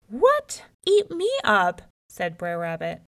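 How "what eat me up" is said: very high, loud disbelief; incredulous. A narrator's reading. Rabbit answers amazed townsfolk, loudly incredulous. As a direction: scoff at an absurd suggestion.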